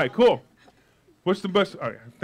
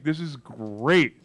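slow, mocking "This is great"; drawn-out sarcasm; 5 semitones lower, much slower than usual. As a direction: stretch it out, dripping irony.

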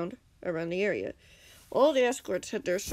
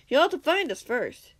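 higher, louder defiant call; confident shout; 6 semitones higher, 6 dB louder than usual. A narrator's reading. Defender challenges villain's threat, shouting confidently. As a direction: raise the voice with confidence. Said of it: "Impulse shouted confidently".

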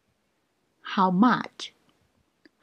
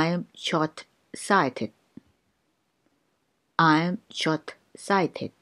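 very slow, each syllable clear; slow teaching pace; slow drill; much slower than usual. Shown as a demonstration. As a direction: spell it out slowly for learners. Slow drill repeated twice.

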